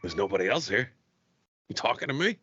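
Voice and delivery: a hint of a loner daring someone in the mirror; Travis Bickle. Retelling a clash with a coworker, a light Travis Bickle mirror taunt. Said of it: "I turned into Travis Bickle".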